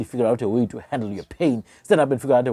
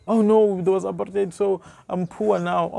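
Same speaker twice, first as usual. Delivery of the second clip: higher-pitched mock complaint, slowing at the end; mock self-pity; 7 semitones higher than usual. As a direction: make fun of a helpless excuse-maker. Mocking an imagined excuse-maker's complaint.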